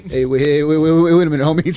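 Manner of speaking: loud wait wait wait; loud protest. Real, not acted. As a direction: call out to stop him. Protesting loudly.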